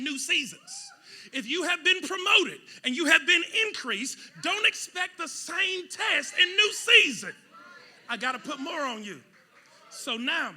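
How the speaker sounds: loud, high preaching; preaching with a laugh in it; full-force preaching. Acted. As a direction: build and drive the point.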